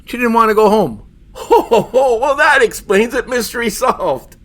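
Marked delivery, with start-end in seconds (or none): 1.3-4.3 mocking laugh. Mocking laugh at flimsy excuse.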